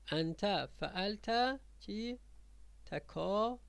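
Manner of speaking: slow, spaced-out teacher reading; slow dictation. Shown as a demonstration. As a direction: say it piece by piece with long pauses. Teacher spells out phrase for pupils.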